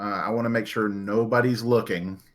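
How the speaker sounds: ordinary delivery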